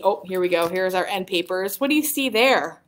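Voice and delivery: plain reading voice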